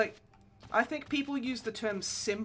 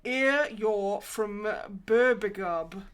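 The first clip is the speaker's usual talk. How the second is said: mock Birmingham accent; 7 dB louder, much slower than usual. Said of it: "my incredibly Birmingham accent".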